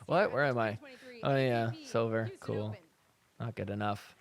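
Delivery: low, soft, dejected muttering; mock zoned-out. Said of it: "She is just zoned out".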